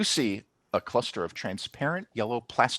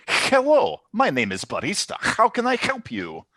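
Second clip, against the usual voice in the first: barista character; 10 semitones higher than usual. Acted. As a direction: greet customers in a put-on high voice. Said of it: "and they say".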